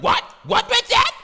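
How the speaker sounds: loud, very high squawk; shrieky falsetto indignation; mock outrage. Acting women's outraged reaction.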